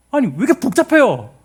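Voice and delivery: high, loud mock protest; acted complaint. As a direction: grumble loudly as if fed up with the rules. 복잡한 규례에 대한 항의 연기. (높고 크게 따지듯 투덜대는 말투)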